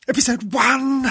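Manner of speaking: loud, high burst of excitement; overexcited outburst. Acted. Host can't contain himself about his first episode, overexcited outburst. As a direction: blurt it out like you can't help it. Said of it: "Too excited".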